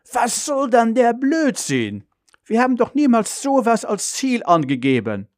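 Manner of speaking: high-pitched, indignant father's protest. A narrator's reading. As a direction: reject the nonsense in outrage. Father indignant at mechanic's finding. (empört, mit hoher Stimme)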